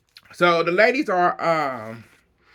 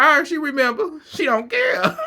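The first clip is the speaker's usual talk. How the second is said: high half-laughing yell; hammed-up Mary, higher; mock Mary; 9 semitones higher than usual. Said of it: "She went".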